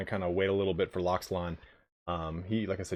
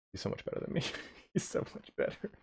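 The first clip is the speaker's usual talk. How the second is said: hushed aside; 11 dB quieter, faster than usual. Real, not acted. Praising a better player, aside.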